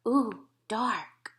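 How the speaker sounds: put-on soft breathy toddler awe in the dark; a small child whispering in the dark; little kid whisper. A narrator's reading. Fudge whispers as the lights dim. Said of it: "Fudge said".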